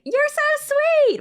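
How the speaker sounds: very high, loud, drawn-out sing-song; gushing reply. Retold or imitated. Replying to a hater's comments, gushing in a squeal. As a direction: squeal it sweetly, stretching the words. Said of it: "I just kept saying things like".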